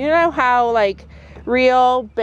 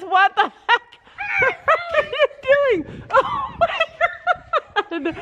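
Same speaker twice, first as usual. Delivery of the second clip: high, laughing disbelief, slowing on oh my God; real laughing outcry; laughing shout; much slower than usual. Real, not acted. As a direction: shriek with laughter at the stunt. Friend doing something risky.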